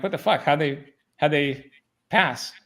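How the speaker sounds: retold disbelief. Retold shock at failing students passing.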